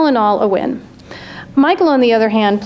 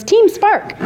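put-on perky girl voice; 9 semitones higher than usual. A narrator's reading. Perky blonde's slogan.

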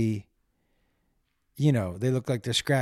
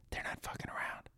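whisper; 18 dB quieter than usual. Real, not acted. Confiding warning about the coyotes.

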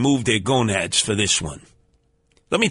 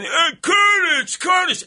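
calling out; slower than usual. Retold or imitated. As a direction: bellow the name like a drunk regular. Bar regulars calling out greeting.

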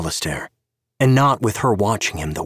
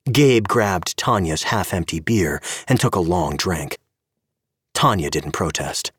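usual speaking voice

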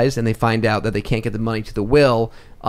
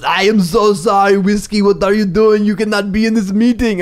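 put-on Austrian accent; 7 semitones higher, 4 dB louder than usual. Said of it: "he goes".

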